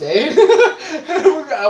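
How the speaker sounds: laughing at his own story. Real, not acted. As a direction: laugh it out loud. Laughs at himself.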